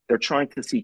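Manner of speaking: normal talking voice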